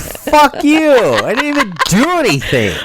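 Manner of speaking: high, loud mocking comeback; mock defensive snap. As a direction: play yourself snapping back, hammed up. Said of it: "And I'm just like".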